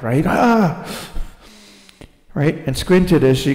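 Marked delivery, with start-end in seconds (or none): 0.3-0.9 sharp high cry of surprise; sudden frightened "Ah!"; startled gasp. Retold or imitated. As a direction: gasp at the blinding light. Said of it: "how quickly maybe her eyes widened".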